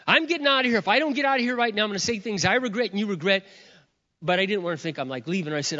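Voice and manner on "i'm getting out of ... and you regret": high, urgent retelling of an angry exit; acted upset. Husband storming out on his wife, acted upset. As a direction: act out a husband at boiling point. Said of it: "I was so upset".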